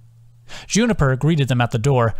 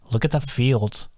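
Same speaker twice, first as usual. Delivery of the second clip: grumpy; 4 dB quieter than usual. Acted. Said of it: "sounding almost grumpy".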